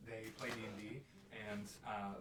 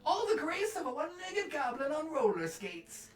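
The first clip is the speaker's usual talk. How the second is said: high, loud hammed-up auntie voice; mocking Auntie; 11 dB louder, slower than usual. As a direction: jeer in a shrieky, drawn-out character voice. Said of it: "she goes".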